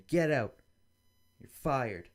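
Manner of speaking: boss firing you. Retold or imitated. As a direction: yell it like a boss throwing someone out. Boss fires the lying candidate.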